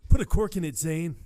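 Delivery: loud, high put-down; loud snap. Retold or imitated. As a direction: tell him to shut it loudly. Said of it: "It's like".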